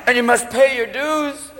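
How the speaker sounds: slow, drawn-out pronouncement; theatrical, long-held words; mock solemn warning. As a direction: stretch it like a grand judgment. Mock dire verdict on the drinker.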